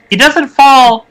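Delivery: ordinary talk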